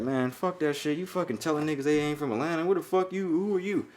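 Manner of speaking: ordinary talk, nothing marked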